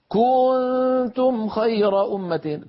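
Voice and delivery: mock the reader who overstresses "كنتم" into kuuntum; wrong stretched recitation. Shown as a demonstration. Teacher imitates brothers who recite it wrongly. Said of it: "بعض إخواننا الله يهديهم يقولوا".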